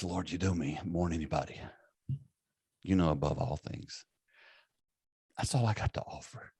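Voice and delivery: understated retold exasperation, soft and low with long pauses; exasperated. Peter answers Jesus the third time, quietly exasperated. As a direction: let the plea sink down, as if nothing is left. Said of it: "that's when he gets exasperated".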